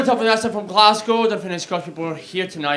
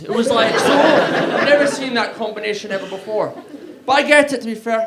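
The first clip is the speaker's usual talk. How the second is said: ordinary talk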